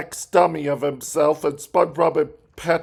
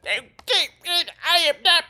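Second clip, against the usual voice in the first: high, slow nasal-free Squidward impression, much higher; 13 semitones higher, much slower than usual. Retelling the cartoon, squidward declares high and slow. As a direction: drag out a haughty Squidward line. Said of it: "He says".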